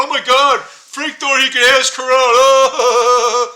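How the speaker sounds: loud, slow, jeering mockery; mock the kid for the whole hallway; mocking passerby. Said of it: "passerbys were just laughing".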